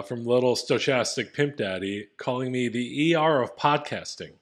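normal talking voice

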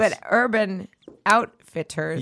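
deliberately slow, word by word; slow and careful. Shown as a demonstration. Tongue-tied host proves they can say the store name, slowly. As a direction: spell it out slowly to get it right. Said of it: "I say it slowly".

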